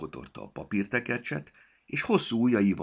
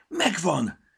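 much higher, loud excited cry; excited shout; 14 semitones higher, 8 dB louder than usual. A narrator's reading. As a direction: shout it with delight. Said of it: "kiáltotta izgatottan".

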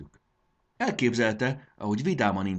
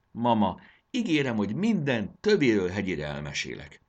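usual speaking voice

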